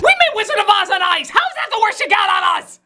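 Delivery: high-pitched, outraged comeback; mock-indignant outburst. Indignant comeback to weak insults.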